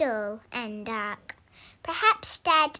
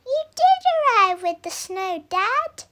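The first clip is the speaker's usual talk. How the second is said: much higher and louder, a delighted yell; boy shouting to Dad when he finally comes home; joyful shout; 10 semitones higher, 10 dB louder than usual. Acted. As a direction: shout it out to Dad in pure joy. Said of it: "shouted Sam".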